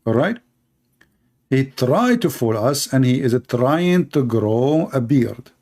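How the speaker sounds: ordinary talk, nothing marked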